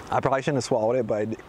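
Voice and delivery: ordinary talk, nothing marked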